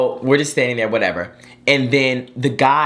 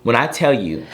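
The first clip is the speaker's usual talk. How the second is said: ordinary talk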